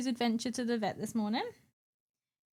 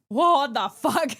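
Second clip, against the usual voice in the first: startled swear; 7 dB louder, slower than usual. Retold or imitated. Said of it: "I was like".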